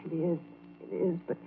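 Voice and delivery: very soft, on the edge of crying; a hushed tearful admission; hushed, near tears. Acted. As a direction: barely get the words out, holding back tears. Alice tearful about jealous husband.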